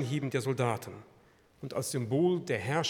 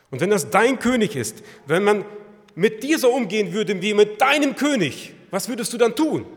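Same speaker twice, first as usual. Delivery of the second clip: loud, high, forceful challenge from the pulpit; impassioned preaching; 7 semitones higher, 11 dB louder than usual. Real, not acted. Preacher confronts congregation about their king, impassioned.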